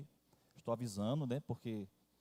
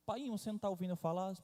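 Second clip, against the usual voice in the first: put-on higher, fast little girl's voice; daughter calling father; 7 semitones higher, faster than usual. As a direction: play the insistent child calling her dad.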